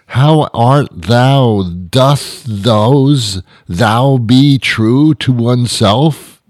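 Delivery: put-on very slow, grandly stretched old English; pompous Shakespearean. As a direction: draw every word out pompously. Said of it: "so pompously ridiculous".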